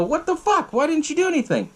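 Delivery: high-pitched indignant demand; mock pissed-off. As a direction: act out an angry accusation, pitched high. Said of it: "they get all pissed off".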